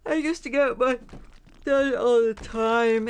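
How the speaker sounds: words stretched through a big yawn; mid-yawn speech. Real, not acted. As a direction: talk right through a high yawn. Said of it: "I don't know why I'm yawning".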